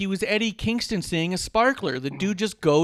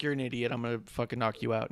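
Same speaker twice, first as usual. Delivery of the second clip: lower, softer menacing Bobby; mock menace; 6 semitones lower, 6 dB quieter, faster than usual. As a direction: threaten him low and quiet. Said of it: "and Bobby's like".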